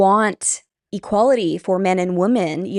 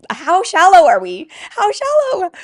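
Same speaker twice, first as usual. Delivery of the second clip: much higher, louder exclamation of disbelief; incredulous outburst; 11 semitones higher, 7 dB louder than usual. Real, not acted. Vlogger appalled by the post she is reading, incredulous. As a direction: pitch leaps up in exasperated amazement.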